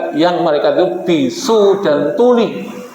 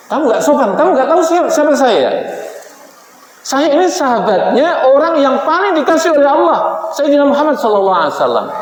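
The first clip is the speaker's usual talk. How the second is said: Umar scolding; 8 semitones higher than usual. Retold or imitated. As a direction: tell off the angel, proud and offended. (omelan Umar yang marah)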